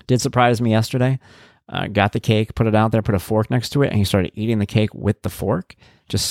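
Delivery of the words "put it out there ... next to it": usual speaking voice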